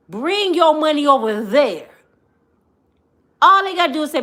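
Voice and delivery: ordinary talk, nothing marked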